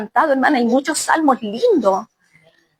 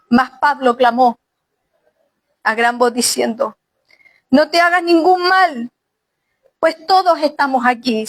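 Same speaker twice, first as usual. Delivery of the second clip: scripture reading. Acted. Preacher reading to the sisters, Paul calling out with a raised voice. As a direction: read it as Paul calling from his cell.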